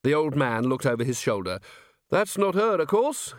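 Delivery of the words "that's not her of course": put-on high-pitched rustic old-timer; old man keeper. Old caretaker explaining the animals to a visitor, high old-man voice.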